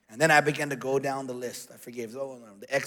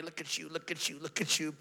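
lightly voiced taunting devil, softer; lying devil; 6 dB quieter than usual. Devil needling him over the dishes, quiet taunt. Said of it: "the devil's lying to me".

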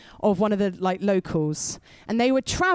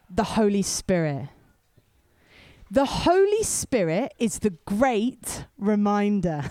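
ordinary talk

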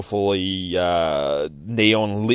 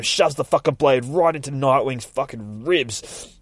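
usual speaking voice

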